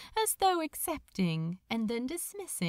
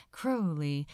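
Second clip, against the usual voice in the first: plain reading voice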